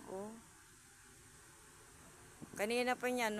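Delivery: ordinary talk, nothing marked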